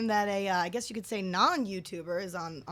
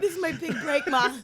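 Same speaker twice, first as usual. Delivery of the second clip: mock kid; 6 semitones higher, 4 dB louder than usual. Teasing kid's voice.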